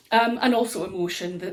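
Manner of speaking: ordinary talk, nothing marked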